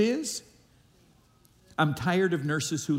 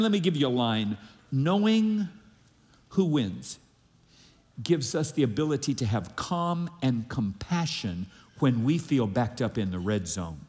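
ordinary talk, nothing marked

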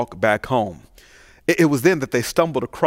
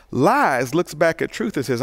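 ordinary talk

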